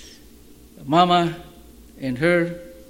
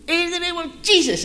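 commanding rebuke; 12 semitones higher than usual. Retold or imitated. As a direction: cast the demon out with full force. Second attempt casting out demon.

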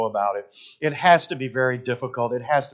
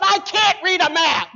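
high, loud, drawn-out wail; hammed-up tearful cry; mock crying wail; 5 dB louder than usual. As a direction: imitate your wife bawling in protest. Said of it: "she started to cry and said".

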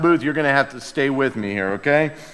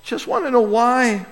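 quiet plea; 9 semitones higher than usual. Retold or imitated. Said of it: "we're like".

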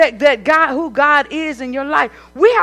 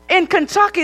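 ordinary delivery

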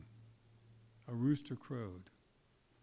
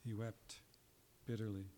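quiet repetition; 2 semitones lower, 7 dB quieter than usual. A narrator's reading. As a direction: let it sink in, hushed. Repeats the verse, softer.

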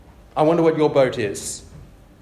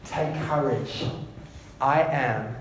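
soft reassurance; 4 semitones lower, 6 dB quieter than usual. Acted. Quoted Jesus, softer.

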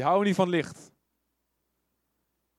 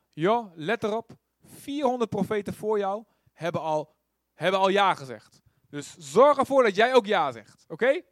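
pressuring messengers; 3 semitones higher, 6 dB louder than usual. Retold or imitated. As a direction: lean on him to say yes. Messengers pressure Micha to agree. (luid aandringend)

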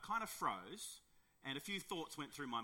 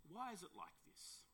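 softly asked, high start on "why"; soft question; 6 semitones higher, 10 dB quieter than usual. Real, not acted. Preacher asking why believers give in, soft questioning. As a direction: pose it softly, lifting "why" then falling.

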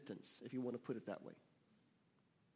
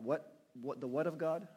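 normal talking voice

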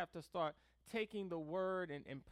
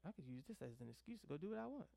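low, quick, hushed aside; sly mutter; 5 semitones lower, 13 dB quieter, faster than usual. Acted. Preacher voicing a private excuse to misbehave, sly mutter. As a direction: think it under your breath, fast and low.

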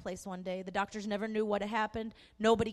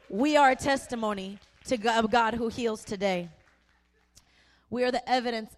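preaching proclamation; 8 dB louder than usual. Real, not acted. Preaching climax, much louder.

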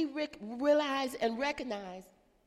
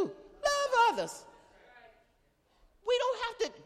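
high slow; 12 semitones higher than usual. Real, not acted. Preacher answers own question.

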